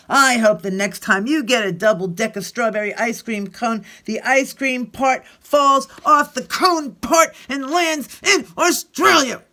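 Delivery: hammed-up kid yelling; angry child outburst. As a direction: spit out the wish at Paul. Angry child curses friend.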